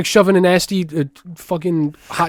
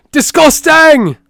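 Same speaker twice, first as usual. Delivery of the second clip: much higher, louder and slow, drawn-out "Disgusting!"; disgusted outburst; 13 semitones higher, 10 dB louder, slower than usual. Acted. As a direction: stretch "Disgusting!" into a big, high, slow yell of disgust. Repeats the word, then escalates.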